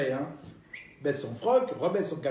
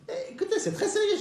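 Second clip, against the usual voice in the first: put-on old lady voice.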